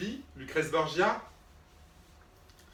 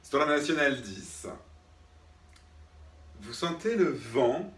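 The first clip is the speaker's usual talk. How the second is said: slow guided exercise. Acted. Drama teacher guiding students through an exercise, slow and hushed. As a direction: lull the class into feeling the wind.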